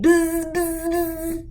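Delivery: put-on high, playful child buzzing; buzzing like a bee. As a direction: buzz around like a little bee. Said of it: "she ran around going".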